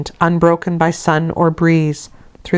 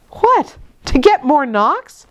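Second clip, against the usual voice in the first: put-on surprised child; 9 semitones higher, slower than usual. A narrator's reading. Jane retorts in story.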